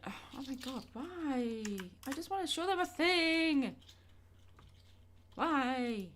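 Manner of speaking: frustrated whine. Real, not acted. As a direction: plead with the machine, fed up.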